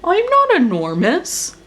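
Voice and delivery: a sulky grumble, stress on not; grumbling. Acted. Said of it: "grumbled Elephant".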